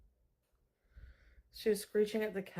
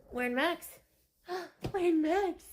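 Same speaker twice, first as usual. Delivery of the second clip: gasping, high, building excitement; mock excited; 9 semitones higher, slower than usual. Owner hyping up her cat, gasping excitedly. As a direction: gasp and hype the cat up.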